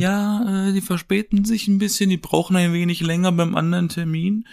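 mover on the phone. Retold or imitated. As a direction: play the mover making excuses.

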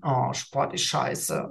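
slow, soft mock grumble; reluctant old self. Speaker imitates his old sport-hating self, slow grumble. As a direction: drag it out, fed up with exercise. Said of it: "wäre ich so gewesen".

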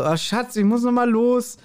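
mock-innocent husband leaving; Rehbein excusing himself. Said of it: "und sagt so".

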